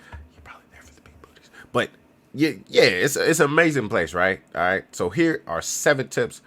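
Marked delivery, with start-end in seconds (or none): 0.1-1.5 breathy secret whisper; whispered aside. Real, not acted. Joking aside to viewers about the real reason, whispered. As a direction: whisper it like a confession.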